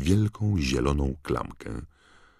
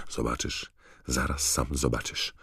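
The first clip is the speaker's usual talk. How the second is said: ordinary delivery, nothing marked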